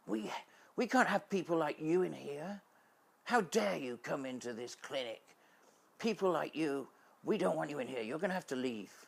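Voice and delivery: loud, high angry scolding; furious. Retold or imitated. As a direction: throw them out in outrage. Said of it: "really furious and angry".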